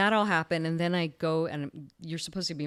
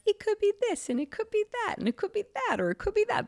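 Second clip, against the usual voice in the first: very high, singsong hedging; mock a waffling pathologist, pitched way up; noncommittal pathologist; 3 dB louder than usual. Doctor mocking how pathologists word their reports, noncommittal singsong. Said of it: "pathologists are never direct".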